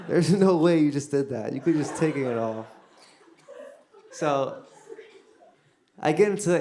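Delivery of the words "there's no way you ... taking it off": loud, higher, laughing protest; hammed-up indignation with a laugh; mock outrage. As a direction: protest in joking disbelief. Mock outrage over cut sweater.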